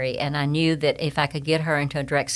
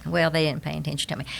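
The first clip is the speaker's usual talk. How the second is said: ordinary talk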